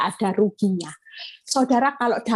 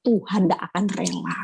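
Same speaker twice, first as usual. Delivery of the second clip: ordinary talk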